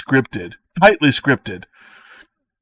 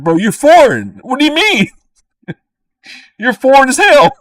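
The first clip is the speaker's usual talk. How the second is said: high, loud, disbelieving outburst; incredulous retort; 12 semitones higher, 6 dB louder than usual. Retold or imitated. Retorting to an anti-immigrant friend, loud and high in disbelief. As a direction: shout it up high like you can't believe it.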